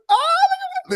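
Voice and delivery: put-on very high, loud Valley Girl squeal; squealing Valley Girl gush; Valley Girl impression. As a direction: coo at it like a giddy Valley Girl. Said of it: "a Valley Girl impersonation".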